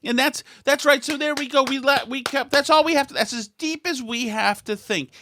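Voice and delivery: high-pitched sarcastic approval; mock enthusiastic agreement. Mock agreement with the joke resumes.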